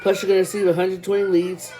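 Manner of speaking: rapid reading pace; reading fast. Real, not acted. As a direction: rattle through the numbers quickly. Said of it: "I read fast".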